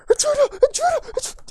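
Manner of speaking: high, full-volume guard's scream; frantic high-pitched shouting; yelling alarm. Acted. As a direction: raise the alarm at the top of your lungs. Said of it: "starts yelling at the top of his lungs".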